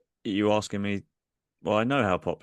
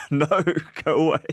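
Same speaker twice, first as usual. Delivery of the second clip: mock gruff refusal; 7 semitones higher, 6 dB louder, much faster than usual. Massive guy refusing.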